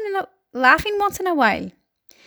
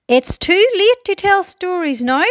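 raised, louder pronouncement; firm announcement; 7 semitones higher, 5 dB louder than usual. A narrator's reading. Old grasshopper ending story time, announcing firmly. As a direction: announce it to the whole room. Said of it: "the old green grasshopper announced".

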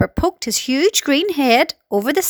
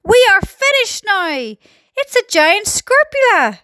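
high, drawn-out wail of doom; slow, high-pitched storybook panic; panicked yell; 10 semitones higher, slower than usual. A narrator's reading. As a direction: wail that all is lost. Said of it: "yelled the head of the fire".

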